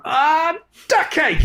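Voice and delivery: high mock cry in place of a swear word; frustrated outburst. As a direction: yelp it like a dad who just stuffed up. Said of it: "is just going".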